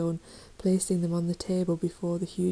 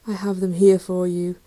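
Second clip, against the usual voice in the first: firm; 7 dB louder than usual. A narrator's reading. Wizard facing the tiger.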